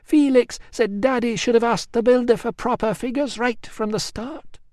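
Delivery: Emilia resigned. Acted. Emilia's account.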